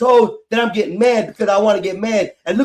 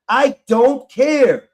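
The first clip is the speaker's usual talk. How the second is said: drawn-out dismissal; 2 semitones higher, much slower than usual. Real, not acted. Upset outburst in argument.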